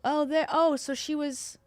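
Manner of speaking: ordinary talk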